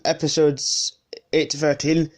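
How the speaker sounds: ordinary talk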